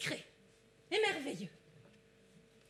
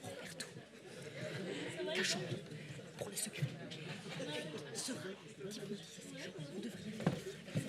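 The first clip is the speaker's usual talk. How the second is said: hushed aside; 4 semitones lower, 5 dB quieter, slower than usual. Acted. Hiding with assistant, aside.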